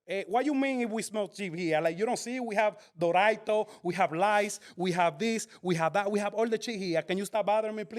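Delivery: put-on exasperated store clerk in a heavy Dominican accent. Clerk snapping at a customer asking for chips, in a Dominican accent. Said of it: "I just did an accent".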